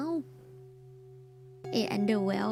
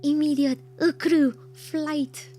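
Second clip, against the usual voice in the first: loud, slow punch on each word; emphatic declaration; 4 semitones higher, 6 dB louder, slower than usual. Real, not acted. Streamer declaring they would flee the banging, emphatic. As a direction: hammer it out slowly and loudly.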